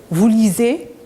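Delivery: ordinary delivery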